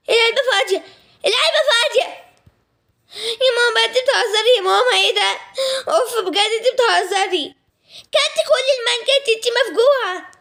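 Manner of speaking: high, half-laughing outrage; shocked accusation. Acted. Daughter to mother over the eaten mango, high, laughing outrage. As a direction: cry out at the empty box, mock-wounded.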